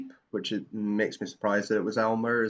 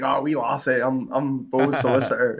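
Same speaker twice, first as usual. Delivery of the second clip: louder mock Scottish lilt; hammed-up Scottish gent; Scottish accent, louder; 4 semitones higher, 7 dB louder than usual. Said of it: "he's like".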